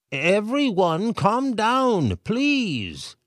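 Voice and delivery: high, loud, slow mayor appeal; mayor pleading for calm. A narrator's reading. Mayor appealing to the panicking crowd, loud and slow. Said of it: "the mayor cleared his throat".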